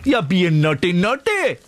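high, hammed-up Geordie; best Geordie attempt. Host imitating a Geordie he knew, badly faked Geordie. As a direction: mimic a Geordie with the pitch leaping right up. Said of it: "that is my best Geordie accent".

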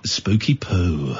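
very low, slow spooky growl. Pretend. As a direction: drop low and drag it out, spooky. Spooky voice for the story.

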